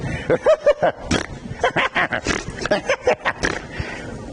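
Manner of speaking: long breathy laugh rising at the end; choir woman's laugh. Retold or imitated. Preacher imitating a choir woman's laugh, long rising cackle. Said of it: "She'd laugh like this".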